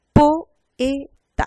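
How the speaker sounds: poeta loud, in three separate beats; syllable by syllable. Shown as a demonstration. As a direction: split poeta as PO, E, TA. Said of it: "ta es la sílaba tres".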